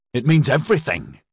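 high, impassioned exclamation; shouted. A narrator's reading. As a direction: cry it out in distress. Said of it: "Edyard shouted".

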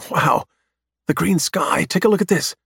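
put-on high, excited young voice; thrilled and eager to go there; enthusiastic. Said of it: "sounds so enthusiastic".

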